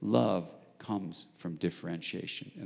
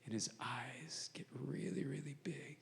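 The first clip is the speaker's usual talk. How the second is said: whispered storytelling, building suspense; 4 semitones higher, 13 dB quieter than usual. Real, not acted. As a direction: drop to an unvoiced whisper for the reveal.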